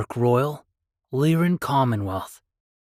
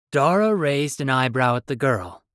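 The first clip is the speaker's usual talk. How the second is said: normal talking voice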